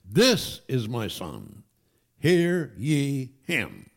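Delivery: loud, very slow proclamation; booming-loud, drawn-out divine decree; voice from heaven. Retold or imitated. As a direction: proclaim it as God from heaven, each word drawn out. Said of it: "a voice came from heaven".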